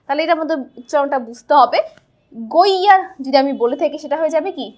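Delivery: ordinary delivery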